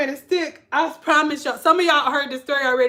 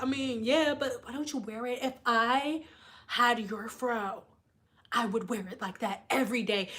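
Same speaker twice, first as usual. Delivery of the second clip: quiet retold reply; 5 semitones lower, 10 dB quieter than usual. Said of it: "And I'll be like".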